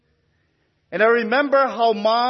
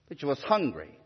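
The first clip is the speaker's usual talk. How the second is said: faint, slurred, much softer and lower; weak slurred speech; 8 semitones lower, 9 dB quieter, faster than usual. Retold or imitated. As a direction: let the words slur out weakly. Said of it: "in her own weak, slurred speech".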